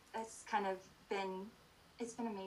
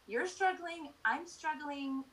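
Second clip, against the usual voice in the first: inner voice quote; 7 semitones higher, slower than usual. Retold or imitated. Inner thought voiced in retelling.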